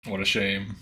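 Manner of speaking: low-pitched mock lament; deadpan game quote. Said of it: "he said it funny".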